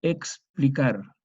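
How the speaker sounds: explicar said slowly with a full ks, eks-pli-KAR; teacher spelling out the sound in explicar; slow careful ks. Shown as a demonstration. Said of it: "una K y una S".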